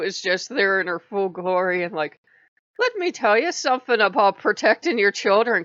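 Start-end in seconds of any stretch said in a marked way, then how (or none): 2.8-5.6 high, loud hammed-up Irish accent; bad Irish accent, high and loud; horrible Irish accent. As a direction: lay on a scolding mock-Irish brogue. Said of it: "she does that same horrible accent".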